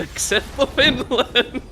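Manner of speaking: laughing through the words. Real, not acted. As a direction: chuckle while speaking. Laughing at own country's loss.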